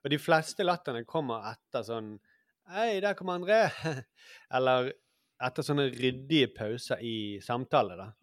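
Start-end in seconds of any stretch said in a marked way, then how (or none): 2.7-3.7 higher-pitched acted greeting; calling a greeting. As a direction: hail an arriving friend in a raised pitch. Acting out a casual greeting. (utrop som hilsen, lysere stemme)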